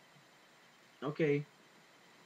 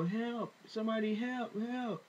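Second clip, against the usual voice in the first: retold cry for help; 6 semitones higher, 3 dB quieter than usual. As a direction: imitate a desperate call without shouting. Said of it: "Trayvon is out there screaming".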